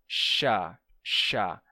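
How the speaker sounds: chá with a hissing sh; demo sound chá. Shown as a demonstration. Teacher demonstrating the sh hiss in chá. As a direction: hold the sh in chá so the air hisses. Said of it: "ou quando você faz".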